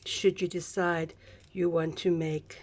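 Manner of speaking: ordinary talk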